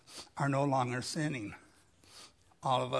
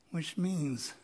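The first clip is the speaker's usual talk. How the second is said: usual speaking voice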